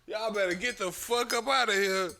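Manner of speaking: loud, high mock rant; hammed-up furious old man; angry homeowner. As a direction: chase them off the yard. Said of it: "He said".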